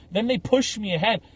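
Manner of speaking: ordinary talk, nothing marked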